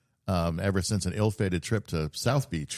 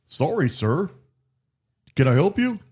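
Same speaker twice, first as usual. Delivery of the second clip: polite staff voice; 9 semitones higher, 6 dB louder than usual. Retold or imitated. Cruise staff stopping him at night.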